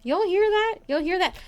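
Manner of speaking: high-pitched, incredulous asking; startled question. Real, not acted. Streamer asks viewers about a sound she heard, startled. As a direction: ask it in sudden alarm.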